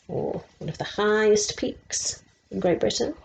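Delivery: ordinary delivery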